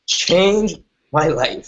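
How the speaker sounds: very slow, choked up with real emotion. Real, not acted. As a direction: let the memory catch in the throat, slowly. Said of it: "Forgive me if I get emotional".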